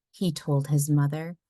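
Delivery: ordinary delivery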